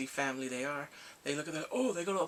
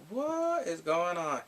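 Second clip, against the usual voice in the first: slow, drawn-out bafflement; baffled disbelief; 3 semitones higher, much slower than usual. Retold or imitated. Viewer reacting to the sudden crying, drawn-out bafflement. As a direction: stretch it out in mock bewilderment.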